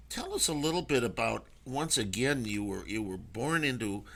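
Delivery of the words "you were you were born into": normal talking voice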